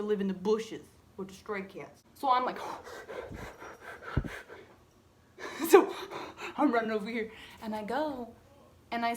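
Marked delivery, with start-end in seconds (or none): 2.6-4.6 breathy shocked gasp; sharp gasp. Real, not acted. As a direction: suck in air in shock. Reacting to the cat.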